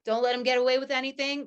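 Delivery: ordinary delivery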